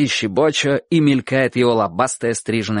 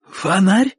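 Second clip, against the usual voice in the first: higher, rising amazed query; sudden surprised question; 7 semitones higher than usual. A narrator's reading. Bocho feels his forehead, asks in surprise. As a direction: ask it with a lift of surprise. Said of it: "вдруг спрашивает он".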